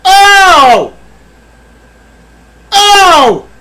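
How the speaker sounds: big high hammed-up shocked Oh; loud mock gasp. Streamer reacting to chat with hammed-up shock. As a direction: overplay the outrage, high and loud.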